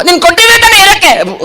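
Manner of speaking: high, protesting puppet voice; argumentative puppet. Acted. Puppet blames host over the chocolates, protesting puppet voice.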